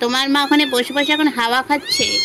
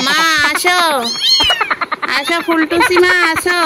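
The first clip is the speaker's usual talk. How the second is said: kitten voice; 3 semitones higher, 6 dB louder than usual. Pretend. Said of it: "বলে".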